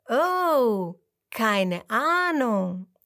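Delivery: put-on slow, friendly English-accented reply. A narrator's reading. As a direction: say it kindly, slowly. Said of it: "sagt sie freundlich".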